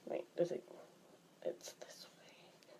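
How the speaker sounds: very soft, slow whisper; whispered aside. Real, not acted. Checking to oneself mid-video, whispered aside. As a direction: whisper it to yourself. Unvoiced aside while counting fingers.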